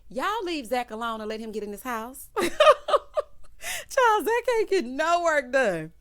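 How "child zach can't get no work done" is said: high laugh into slow loud complaint; laugh, then drag out the complaint; laughing emphasis. Real, not acted.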